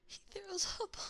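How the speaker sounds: high, laughing through the words; can barely get it out for laughing. Real, not acted. Laughing retelling disaster.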